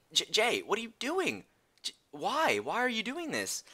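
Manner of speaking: stammering disbelief; disbelieving brother. Retold or imitated. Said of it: "I'm just like".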